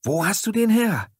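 high, loud screech; screeching women's voice, very high; shrieked question. A narrator's reading. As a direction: demand it in a shrill squeal.

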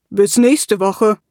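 slow, rumbling parting call; dwarf calling goodbye, rolling pebbles; pebbly dwarf voice. A narrator's reading. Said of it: "Stimme klang wie kullernde Kiesel".

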